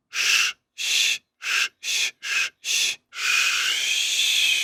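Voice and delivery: "Sh" hissed with the tongue pulled back, darker; a deep, unvoiced "sh" hiss. Shown as a demonstration. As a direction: hiss "sh" low and soft, no voice. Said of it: "but in a lower tone".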